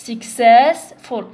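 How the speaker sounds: successful громко, с ударением и растянутым cess; дави на cess в successful; stress on second syllable. Shown as a demonstration. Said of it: "رانا نطولوا في السيلابو الثاني".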